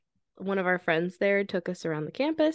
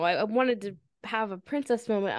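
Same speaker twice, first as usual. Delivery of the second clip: ordinary talk, nothing marked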